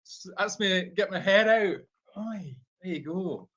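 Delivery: easygoing Scottish comedian impression; Billy Connolly, Scottish accent. Shown as a demonstration. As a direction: put on a Scottish comic's accent. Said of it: "That's my Billy Connolly".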